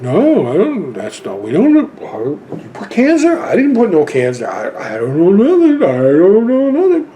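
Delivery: mock clueless denial, swooping up and down in pitch; stammering, hammed-up denial with rising pitch; feigned innocence. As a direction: play dumb, badly faking innocence. Feigned denial to the sound guy.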